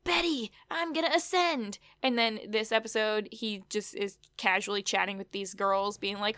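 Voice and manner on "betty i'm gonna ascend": slightly higher, hammed-up solemn vow; lightly voiced dramatic Jughead; a hint of a cult convert; mock grand Jughead. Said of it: "he was like".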